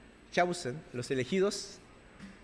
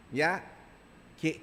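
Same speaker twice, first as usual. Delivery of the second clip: ordinary delivery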